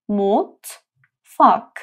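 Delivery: مطفاک stretched very slowly; slow syllable demo. Shown as a demonstration. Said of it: "تلفظ این حرف م". (مطفاک آهسته و کشیده)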